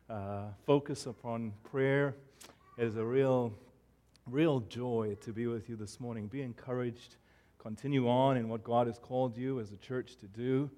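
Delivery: ordinary delivery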